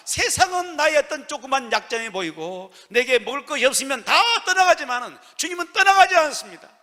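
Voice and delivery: loud, rising pulpit assurance; impassioned preaching. Acted. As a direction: drive each promise home at full voice. Preaching at full force. (큰 소리로 열정적으로 외치는 설교)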